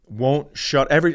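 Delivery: ordinary delivery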